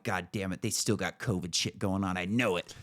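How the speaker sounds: low, hushed grumble to himself; quiet retold griping; grumbled aside. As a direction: drop it under your breath, annoyed.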